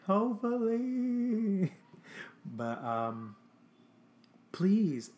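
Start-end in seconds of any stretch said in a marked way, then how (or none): 0.1-1.7 very high, slow, drawn-out hopefully; mock hopeful. Drawn-out hopeful joke after laugh. 4.5-5.1 higher and louder please; emphatic plea. Real, not acted. Pleading sign-off to viewers.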